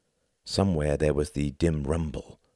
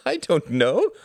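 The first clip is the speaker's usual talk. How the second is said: high, lightly laughing reply; 5 dB louder than usual. A narrator's reading. Klaus answers Tom with a shrug, laughing gaily. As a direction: toss it off with a merry laugh. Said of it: "laughed Klaus gaily".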